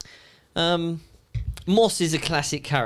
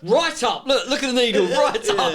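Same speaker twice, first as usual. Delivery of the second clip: higher, laughing mock excitement; laughing boss; 7 semitones higher than usual. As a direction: point at the needle, delighted. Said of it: "and he goes".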